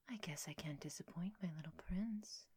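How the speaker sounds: soft intimate whisper. Acted. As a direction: hush it, close and sweet. Mom roleplay whisper.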